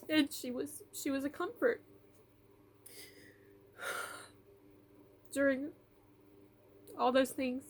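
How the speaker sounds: real teary catch in the throat; tearful, voice breaking. Real, not acted. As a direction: speak through tears, voice cracking high. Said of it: "I didn't mean to get this emotional".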